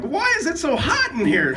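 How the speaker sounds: high-pitched comic whine of complaint; Kramer voice. Retold or imitated. Friend overheating in the car's passenger seat, imitated Kramer voice. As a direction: complain in a high, put-on sitcom voice. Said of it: "probably in his Kramer voice".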